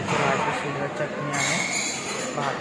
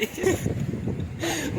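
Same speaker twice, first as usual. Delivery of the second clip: soft laugh; 11 semitones higher than usual. Real, not acted. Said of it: "तोंडल्या पुढे हसते ती".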